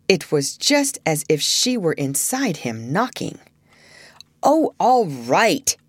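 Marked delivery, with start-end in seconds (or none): 4.4-5.8 reluctant giving in. Acted. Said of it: "he said at last and gulped".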